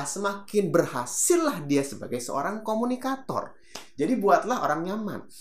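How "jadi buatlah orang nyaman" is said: normal talking voice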